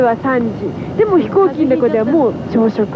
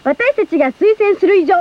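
higher-pitched scripted radio promotion; hyped song plug; 5 semitones higher, faster than usual. Acted. Idol recommending her group's sure hit, hyped announcer patter. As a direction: sell the record like an announcer. Hypes own new song.

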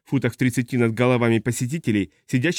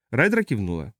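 ordinary delivery

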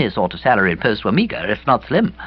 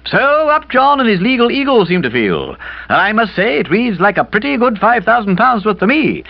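put-on higher, brisk older lady; brisk Aunt Dahlia; 10 semitones higher, 3 dB louder than usual. A narrator's reading. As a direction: lay out the evidence briskly.

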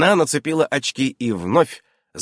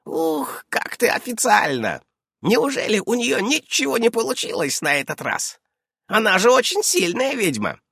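put-on high-pitched, teasing old witch; high witch voice, marvelling; 13 semitones higher than usual. A narrator's reading.

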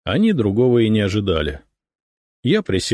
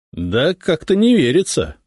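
sceptical, pitched-up remark; doubtful; 9 semitones higher, 4 dB louder than usual. A narrator's reading. Officer remarking on the sotnik's claim, doubtful. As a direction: voice open doubt. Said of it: "с сомнением заметил он".

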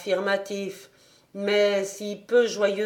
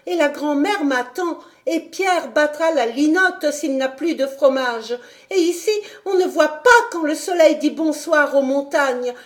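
put-on higher, louder child pouring out her heart; impetuous outburst; 6 semitones higher, 5 dB louder than usual. A narrator's reading. Homesick girl crying out to the governess, impetuous and high. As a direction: burst out passionately like a homesick girl. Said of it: "elle s'écria avec impétuosité".

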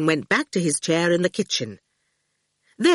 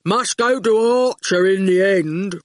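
goon voice; 4 dB louder than usual. A narrator's reading. Goon character speaking.

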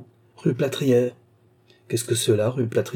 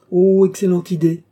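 high, loud delighted cry; excited exclamation; 8 semitones higher, 8 dB louder than usual. A narrator's reading. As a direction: seize on the idea with glee. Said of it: "s'écria la comtesse".

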